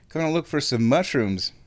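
rising giddy excitement; bubbling over with glee; excited. Retold or imitated. Excited plan to go out.

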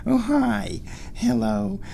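high cooing pet voice. Real, not acted. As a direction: coo hello to the kitty. Greeting the cat.

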